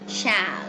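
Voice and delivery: "shall" with a full open a vowel; strong form of shall. Shown as a demonstration. Teacher models the strong form, carefully. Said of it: "pronounced with this vowel sound".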